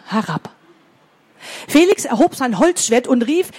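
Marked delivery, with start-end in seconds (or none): none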